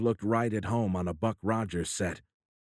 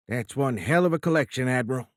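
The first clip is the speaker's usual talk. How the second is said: louder, higher, impressed Midwestern president; booming admiration for the collection; hearty president; 4 semitones higher, 4 dB louder than usual. Acted. President praising the admiral's collection, hearty and loud.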